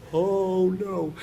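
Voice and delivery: high mock-tearful "oh no"; retold teary dismay. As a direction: act out welling up, pitched high. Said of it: "it makes me cry".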